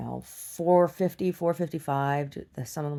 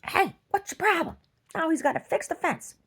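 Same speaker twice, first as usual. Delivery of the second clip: high, put-on scolding voice; repeating the scolding of the bull for fun, high put-on voice; mock scolding; 3 dB louder than usual. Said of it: "replaying the line for fun".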